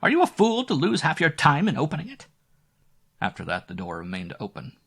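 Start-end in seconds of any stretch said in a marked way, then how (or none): 0.0-2.3 put-on high, loud scolding old woman. A narrator's reading. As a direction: snap at her like a cross old landlady. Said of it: "I heard the old woman say".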